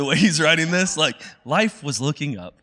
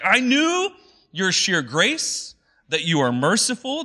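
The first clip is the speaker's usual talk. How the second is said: higher, whining complaint to God; whiny complaining Jonah; 5 semitones higher, slower than usual. Retold or imitated. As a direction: whine it out like a sulking prophet. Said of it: "complaining to God".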